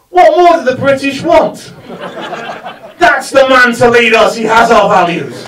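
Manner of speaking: loud, laughing mock rally cry; mock rant. Real, not acted. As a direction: hail the leader through laughter.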